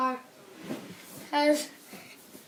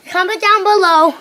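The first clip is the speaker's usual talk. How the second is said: loud plea; 10 dB louder than usual. Real, not acted.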